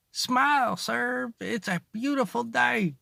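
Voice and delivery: high, sing-song stranger telling him to smile; cheery lady. Retold or imitated. Stranger urging the sick dad to smile, high and sugary. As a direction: put on a chirpy, too-sweet voice.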